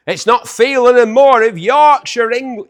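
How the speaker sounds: loud, high-pitched put-on Yorkshire accent. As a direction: boom it out in mock Yorkshire. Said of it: "Is that a Yorkshire accent?".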